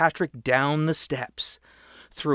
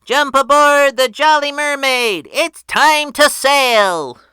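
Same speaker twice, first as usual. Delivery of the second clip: megaphone announcement; 12 semitones higher, 11 dB louder than usual. A narrator's reading. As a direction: boom it like a captain. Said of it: "Patrick announced".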